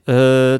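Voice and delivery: "うー" as a long er with closed mouth; level, held-in "うー"; muffled vowel. Shown as a demonstration. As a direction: sustain "うー" without opening the mouth. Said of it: "口を開けずに".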